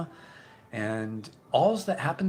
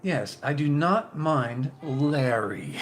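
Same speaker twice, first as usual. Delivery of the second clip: prolonged pronunciation; 3 semitones higher, 5 dB louder, much slower than usual. Shown as a demonstration. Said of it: "The, the prolonged pronunciation".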